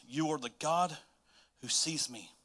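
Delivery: ordinary talk, nothing marked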